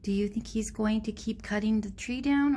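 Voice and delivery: plain reading voice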